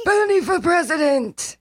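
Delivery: high loud mock Bernie chant; Bernie Sanders voice. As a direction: cheer it like a rally chant. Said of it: "Bernie impression".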